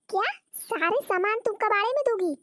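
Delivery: high-pitched, incredulous questioning; shocked disbelief. Acted. Mother-in-law hears everything goes to scrap, shocked disbelief. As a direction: react aghast, pitch swooping up.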